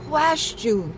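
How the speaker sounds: high, sharp who asked you; sassy retort. Pretend. Scolding a meddler who touched the altar, sassy. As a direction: snap back at a meddler.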